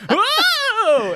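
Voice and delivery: very high squeaky yelp; mock NPC yelp. Telling friends about the NPC, high mock yelp. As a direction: squeak it out like a startled cartoon. NPC voiced in gaming anecdote.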